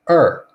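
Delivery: isolated, emphatic "er" with r-coloured vowel; er sound demo. Shown as a demonstration. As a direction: sound out "er" loudly, as in her. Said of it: "it's pronounced like this".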